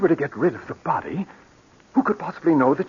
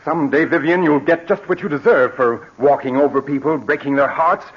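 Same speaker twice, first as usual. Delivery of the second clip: louder, higher rebuke building to a boil; rising anger; 4 semitones higher, 4 dB louder than usual. Acted. Brother berating his sister for breaking hearts, building fury. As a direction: let the contempt climb until it breaks.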